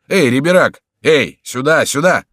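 loud, high hail across distance; calling out. A narrator's reading. Friends to an approaching rider, calling loudly. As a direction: call a rider over.

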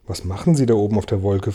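usual speaking voice

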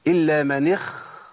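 اخ- ending on a long breathy kh; ghayn as kha. Shown as a demonstration. Teacher asking is it kha or not, deliberately mispronounced. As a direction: stretch the wrong kh at the end of اخ-. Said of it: "خاء ولا لأ؟".

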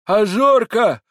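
put-on high, loud, slow old man calling; trembling old man. A narrator's reading. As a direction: call the dog in a quavering old voice. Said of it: "прошамкал он дрожащим старческим голосом".

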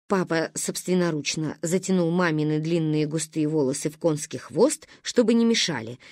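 usual speaking voice

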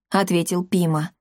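ordinary delivery

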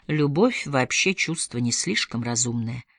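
soft, low and slow remark; gentle. A narrator's reading. Elinor answers Roddy's confession, gently. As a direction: ease off into a gentle, unhurried observation. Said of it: "мягко заметила Элинор".

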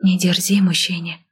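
ordinary delivery

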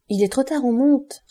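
high, loud urgent warning; alarme. A narrator's reading. Women warn the fugitive of pursuers. (avertissement affolé, aigu et fort)

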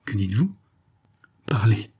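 whispered Parlez, quick and eager; an eager young man murmuring urgent questions. Acted. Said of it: "murmura Léon avec vivacité".

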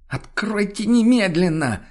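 high, drawn-out imperious cry; shrieked demand. A narrator's reading. Woman outside demands to be let in, shrieking. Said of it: "потребовал голос снова".

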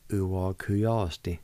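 "Uwa Kuyasti" said slowly as one clear word; slow whole word. Shown as a demonstration. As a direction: blend "Uwa Kuyasti" slowly, every sound distinct. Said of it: "It's pronounced like this".

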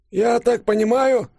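put-on very high, slow old professor; drawn-out, high-pitched elderly deduction; Лыков. A narrator's reading. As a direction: venture it slowly in a high old man's voice. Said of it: "поправил съехавшие очки Лыков".

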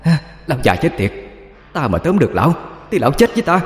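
high, fast ghost yelling curses; a raving possessed shriek, pitched high; frantic high-pitched cursing. A narrator's reading. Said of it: "miệng không ngừng la lên".

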